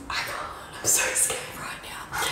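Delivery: real frightened whisper, barely voiced; scared whisper. Real, not acted. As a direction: whisper so the people outside can't hear. Hiding, scared of strangers outside.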